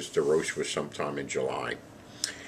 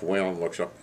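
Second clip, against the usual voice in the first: usual speaking voice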